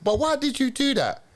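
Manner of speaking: high incredulous retold question; baffled question. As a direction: ask it truly puzzled, voice leaping up. Recalling puzzled thought next morning.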